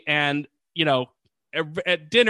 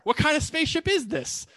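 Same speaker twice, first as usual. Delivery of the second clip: mocking; 12 semitones higher than usual. Mocking quote of crew.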